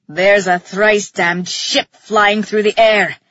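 loud, low, slow character yell; low-pitched shout of alarm; Theron yelling. A narrator's reading. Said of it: "Theron yelled".

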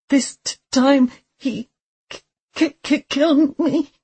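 high, stuttering with fear; terrified stammer. Acted. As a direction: stumble over the words, panicking.

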